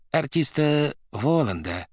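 sweet request. A narrator's reading. Administrator asking for the artiste by telephone, sweetly. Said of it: "сладко спросил Варенуха".

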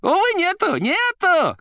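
very high, loud squealing cry; shouting down phone. A narrator's reading. The receiver saying he is not home, shouting shrilly. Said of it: "кричала трубка".

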